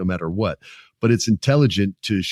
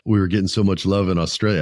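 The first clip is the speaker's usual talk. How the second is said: normal talking voice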